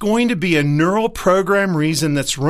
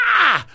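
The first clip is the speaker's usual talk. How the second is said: gulping gasp; 10 semitones higher than usual. Retold or imitated. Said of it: "you go".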